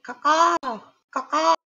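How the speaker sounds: high squawking crow calls; crow caw. Pretend. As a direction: caw like a bird, arms flapping. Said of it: "I'm gonna wave my arms and be like".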